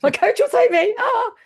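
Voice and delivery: high, loud, laughing mock alarm; hammed-up panicked wail. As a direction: squeal it as a runner afraid of being caught. Mimics runner dreading the coach.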